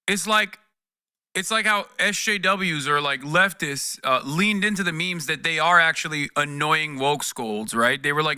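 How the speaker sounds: ordinary delivery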